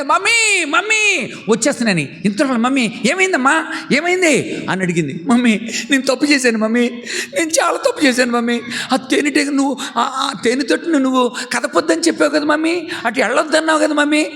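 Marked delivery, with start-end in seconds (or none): 0.0-2.2 put-on high, frightened child crying for mummy; a little girl panicking as bees chase her; scared child. Child calling mummy as bees chase her, high and scared. 2.6-4.7 high, anxious asking voice; worried mother. Acted. Mother asking frightened child what happened, anxious. 5.3-14.2 put-on high, whimpering child's confession; a sorry little girl near tears; tearful child. Daughter confessing to mummy she disobeyed, tearful.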